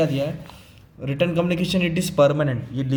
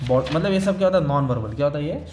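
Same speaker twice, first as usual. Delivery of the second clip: ordinary talk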